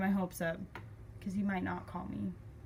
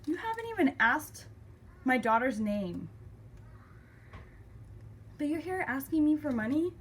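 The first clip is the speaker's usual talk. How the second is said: raised, emphatic disbelief; indignant confrontation; 7 semitones higher, 3 dB louder than usual. Retold or imitated. Daughter confronting dad over baby's name, indignant. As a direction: retell the reproach, loud and incredulous.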